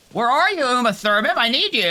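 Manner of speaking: loud high call across the field. Pretend. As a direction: shout out searching for Uma. Calling for missing NPC.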